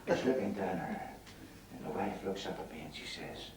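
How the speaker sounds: ordinary talk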